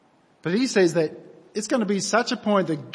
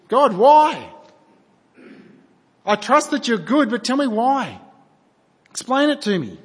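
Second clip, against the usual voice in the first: higher and louder, urgent plea; raised pleading voice demanding why; pleading cry to God; 4 semitones higher, 5 dB louder than usual. Acted. Model prayer crying out to God.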